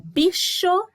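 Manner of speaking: "bicho" em BI e CHO bem marcados; separação de sílabas. Shown as a demonstration. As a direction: dite "bicho" em duas sílabas. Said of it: "duas sílabas". ("bicho" silabado, mais agudo)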